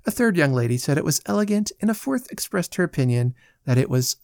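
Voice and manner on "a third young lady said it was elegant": usual speaking voice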